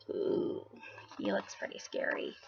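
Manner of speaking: unvoiced scared whisper. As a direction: whisper it like a secret. Narrator aside to children.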